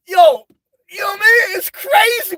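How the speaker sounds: high, wailing laugh-talk; can barely get it out for laughing; cracking up at his own joke; laughing hard. Real, not acted. Laughing rant about school buses.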